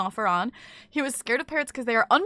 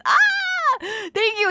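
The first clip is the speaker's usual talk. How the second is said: high, loud squeal of delight; delighted squeal at a gift; excited squeal; 11 semitones higher, 5 dB louder, much slower than usual. Real, not acted. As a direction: shriek the thanks happily. Squeal of thanks.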